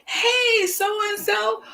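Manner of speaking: very high, friendly hello back; cheerful returned greeting. Acted. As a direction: answer a greeting in a high singsong.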